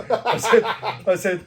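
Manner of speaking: normal talking voice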